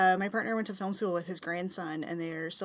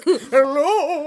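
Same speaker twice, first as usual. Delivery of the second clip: laughing, higher ghostly hello; spooky ghost greeting, laughing; 7 semitones higher, 11 dB louder than usual. Retold or imitated. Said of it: "I said that in a ghost voice".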